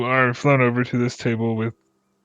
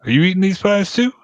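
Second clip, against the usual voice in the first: eager pie man; 9 semitones higher, 5 dB louder than usual. Acted.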